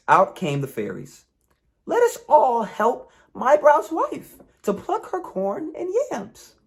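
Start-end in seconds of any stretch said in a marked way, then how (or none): 1.9-6.6 high, mischievous fairy voice; gleeful sprites about to spoil the crop. Acted. Fairies cheerfully offer to help the wife, high and mischievous.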